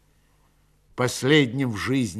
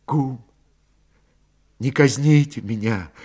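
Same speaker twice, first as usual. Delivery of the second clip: halting plea with long pauses; understated appeal for mercy, broken by silences; subdued plea. A narrator's reading. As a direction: beg haltingly, without raising the voice. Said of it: "попросил Петро".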